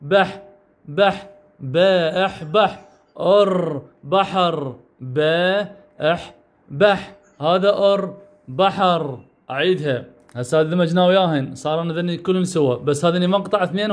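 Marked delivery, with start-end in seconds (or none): 1.6-9.2 باء أح بح then أر بحر, sounded out slowly; slow syllable blending. Shown as a demonstration. Teacher sounding out بحر slowly for learners. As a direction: build the word syllable by syllable, unhurried. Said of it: "هذا باء وهذا الأح".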